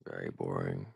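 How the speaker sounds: low, dragged-out bored voice; bored drawl. Real, not acted. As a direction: sigh out how dull it was. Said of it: "very very boring".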